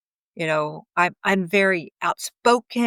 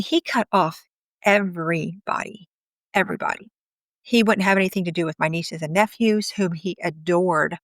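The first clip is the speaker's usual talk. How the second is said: ordinary talk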